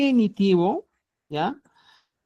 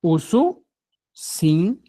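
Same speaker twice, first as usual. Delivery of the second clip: Ususin, sílaba por sílaba y muy despacio; Ususin very slow; much slower than usual. Shown as a demonstration. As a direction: estira Ususin para que el alumno lo repita. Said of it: "podrías decir". (Ususin dicho muy lento, con subida al final)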